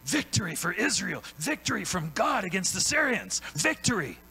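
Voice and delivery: high, loud ringing proclamation of victory; proclaiming prophet. Acted. Preacher voicing Elisha to the congregation, high and loud proclamation. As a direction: cry it out like a prophet declaring triumph.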